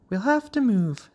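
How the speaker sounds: high-pitched mother frog voice. A narrator's reading. Mother to father in the crowded pond, high voice.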